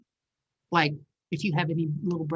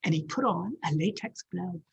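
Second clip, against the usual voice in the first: usual speaking voice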